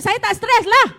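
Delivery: loud, high, snapping denial; angry denial. Retold or imitated. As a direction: shout it like someone who insists they are calm. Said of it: "Ada orang kata".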